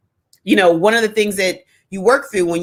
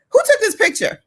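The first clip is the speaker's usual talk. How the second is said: much higher teasing question; higher, teasing mock disbelief; mock incredulous; 14 semitones higher than usual. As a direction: poke fun at the posed photos. Mocking yoga photo posts.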